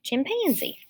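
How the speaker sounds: chimpanzee sung out high and bright. Shown as a demonstration. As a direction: lift chimpanzee up high to celebrate. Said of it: "So let's read it".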